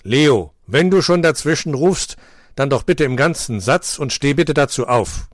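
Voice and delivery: teacher voice. A narrator's reading. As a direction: mildly scold the pupil who shouted. Teacher voice in story.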